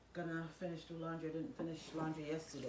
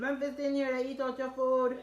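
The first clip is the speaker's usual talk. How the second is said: louder, sterner repeat; insist, voice raised higher; scolding; 8 semitones higher, 12 dB louder than usual. Real, not acted.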